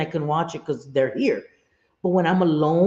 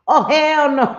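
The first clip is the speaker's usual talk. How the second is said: laughing hell no; 9 semitones higher, 6 dB louder, slower than usual. Retold or imitated. Retelling seeing a ghost grandma.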